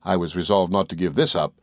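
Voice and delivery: ordinary delivery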